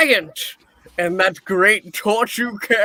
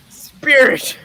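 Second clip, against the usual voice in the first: Scrooge begs the spirit, voice pushed higher and louder; pleading; 5 semitones higher, 4 dB louder than usual. Acted. Scrooge begs the ghost to stop, pleading. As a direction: plead to be let go.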